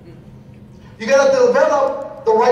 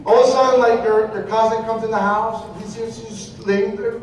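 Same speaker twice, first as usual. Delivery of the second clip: ordinary delivery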